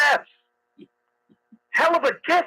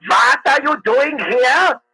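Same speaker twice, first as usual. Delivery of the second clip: slow, higher mock German movie accent; German guy accent; 5 semitones higher, slower than usual. Shown as a demonstration. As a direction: play a movie German, drawing the words out in a German accent. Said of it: "it's a German guy".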